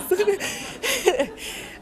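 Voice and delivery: short amused giggle; a light embarrassed laugh. Real, not acted. Laughs after the joke.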